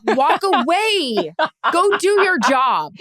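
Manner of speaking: very slow, emphatic self-talk; self-scolding. Retold or imitated. Host scolds himself to walk away, very slowly. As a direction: spell it out to yourself slowly. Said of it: "I was like".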